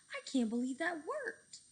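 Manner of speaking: loud thrilled cry; cried out. A narrator's reading. Cheeseball amazed the plane flies, loud excited cry. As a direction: shout it in disbelief. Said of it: "cried Cheeseball".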